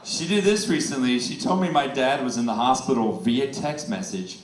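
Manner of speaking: ordinary talk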